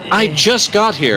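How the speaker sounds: excited arrival. Acted. Arriving, out of breath.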